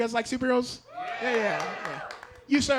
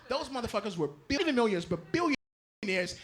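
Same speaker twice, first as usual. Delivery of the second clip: usual speaking voice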